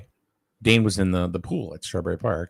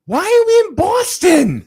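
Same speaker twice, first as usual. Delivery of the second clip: put-on very high, loud, drawn-out child's cry; mock baffled shout; 11 dB louder, slower than usual. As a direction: imitate a kid yelling in disbelief. Retold child's baffled outcry.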